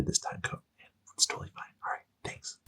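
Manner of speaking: whispered aside. Real, not acted. Quiet aside to viewers.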